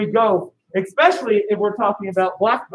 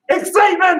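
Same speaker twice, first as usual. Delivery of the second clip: shouted call; 9 semitones higher than usual. Shown as a demonstration. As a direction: shout the word for the class. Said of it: "So everybody say".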